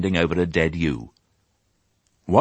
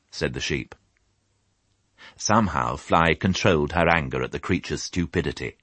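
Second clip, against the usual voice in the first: ordinary delivery, nothing marked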